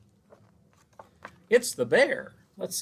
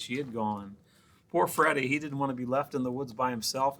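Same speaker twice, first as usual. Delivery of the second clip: usual speaking voice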